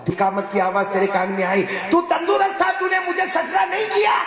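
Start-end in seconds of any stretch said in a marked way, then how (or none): 1.9-4.2 high shouted rebuke; angry God. Acted. God rebuking the man who never bowed, shouting. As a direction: hurl the accusation. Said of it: "اللہ غصے میں کہیں گے".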